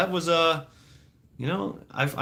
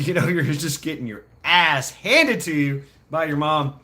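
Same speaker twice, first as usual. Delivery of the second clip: ordinary delivery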